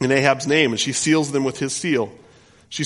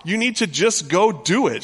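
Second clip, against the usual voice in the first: mocking, high and loud, goading the king; wife mocks husband into grabbing the land, sarcastic; sarcastic taunt; 7 semitones higher, 6 dB louder than usual. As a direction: needle him scornfully, pitched up. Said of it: "hear the sarcasm".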